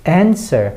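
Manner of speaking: "answer" with strong "an", falling after; first-syllable stress. Shown as a demonstration. Teacher shows the word stress, strong first syllable in "answer". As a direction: punch the "an" of "answer". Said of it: "바로 N의 강세가 있죠".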